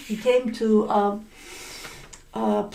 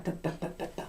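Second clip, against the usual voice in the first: whispered snipping noise; unvoiced ta ta ta snips; scissors snip sound; 6 semitones lower, 15 dB quieter, much faster than usual. Shown as a demonstration. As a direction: softly mimic scissors cutting hair. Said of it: "imitates scissors cutting hair".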